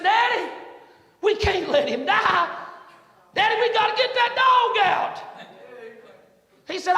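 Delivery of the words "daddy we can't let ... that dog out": put-on high, loud desperate plea from a boy; child's pleading cry. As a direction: beg your daddy, voice raised high. Son begs father to save dog.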